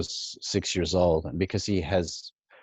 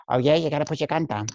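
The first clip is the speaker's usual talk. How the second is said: higher, fast Pacino-style street voice; Al Pacino impression; 5 semitones higher, much faster than usual. Teen imitating Pacino bargaining with Sal, fast and edgy. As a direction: do Pacino in Dog Day Afternoon. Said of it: "Al Pacino in Dog Day Afternoon".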